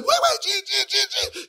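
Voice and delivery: very high, panicked mock pleading; Martin hollering. Husband calling his wife back, high mock holler. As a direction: squeal after her like a sitcom husband. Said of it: "He goes hollering".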